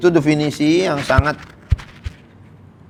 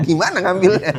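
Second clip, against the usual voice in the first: laughing question; 6 semitones higher than usual. Retold or imitated. Asker laughing.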